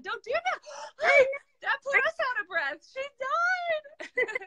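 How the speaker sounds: high, drawn-out mock distress; playful scolding. Real, not acted. Host teasing the guest who vanished from the live, mock-distressed scolding. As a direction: tease with exaggerated alarm.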